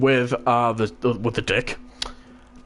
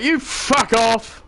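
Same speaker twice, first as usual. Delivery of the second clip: higher, drawn-out angry dismissal; angry retort; 7 semitones higher, much slower than usual. Retold or imitated. Hostile cadet ordering the teller away, angry.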